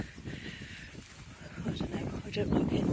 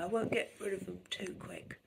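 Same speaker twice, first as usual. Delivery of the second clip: whisper; 4 semitones lower, 11 dB quieter than usual. Real, not acted. Conspiratorial aside about cat.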